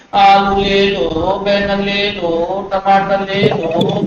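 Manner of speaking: slow singsong street-seller call; imitated hawker's drawn-out cry; mock vegetable hawker. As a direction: chant it like a vendor, dragging each ending. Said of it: "مثلاً تو ایسا لگے گا".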